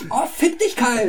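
high, loud mock outburst; mock angry Cartman. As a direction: Cartman flips out at Kyle, hammed-up. Cartman snaps at Kyle. (gespielt wütend, hoch und laut)

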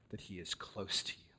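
usual speaking voice

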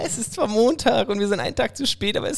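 high, bright-energy podcast opener; show intro announcer. Acted. Host welcoming listeners to the new episode, upbeat announcer read.

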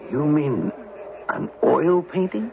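startled question; surprised. Acted. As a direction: pitch jumps high in disbelief. Astonished at the painting idea.

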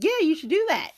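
high, loud eager encouragement; mock her own eager yes; recalling how she egged them on, mimicking brightly; imitating her past self. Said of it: "was like".

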